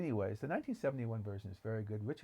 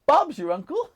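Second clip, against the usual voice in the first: much higher and much louder, bursting with glee; overjoyed housekeeper; 16 dB louder than usual. Retold or imitated. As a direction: shout it out, overwhelmed with happiness. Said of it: "She's so happy".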